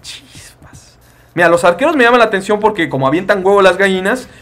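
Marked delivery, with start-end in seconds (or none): 0.0-1.0 breathy whisper of awe; whispered chispas. Real, not acted. Streamer browsing units, awed whisper. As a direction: hush it under your breath.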